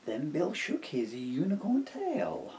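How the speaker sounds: ordinary delivery, nothing marked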